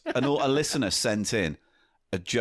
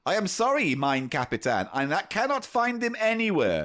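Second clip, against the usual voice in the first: higher comic German underling; German accent; 5 semitones higher than usual. Acted. German soldier apologising to his Kapitan, German accent. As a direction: report back in a German accent. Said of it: "German accent".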